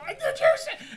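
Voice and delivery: very high, loud squeal; hammed-up excitement; mock excited fan. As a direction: grab someone and squeal it like an excited wife. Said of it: "she gets real excited".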